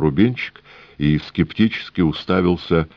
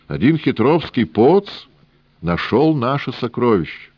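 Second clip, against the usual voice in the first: put-on high, sing-song Odessa-style old man; 7 semitones higher than usual. A narrator's reading. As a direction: play the cunning old trader, lilting.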